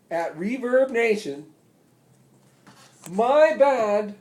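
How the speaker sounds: loud, high stage announcement; booming rally speech from the stage; addressing the crowd. Retold or imitated. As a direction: proclaim it from the stage to thousands. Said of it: "I say to them".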